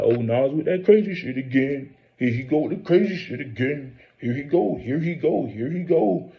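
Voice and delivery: a mild mock of dismissive critics; mocking doubter. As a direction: brush him off in a hammed-up sneer. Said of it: "you gonna be like".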